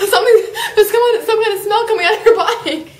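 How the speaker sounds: high, loud, fast shouting; fast alarmed shout. Acted. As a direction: rattle it off in alarm.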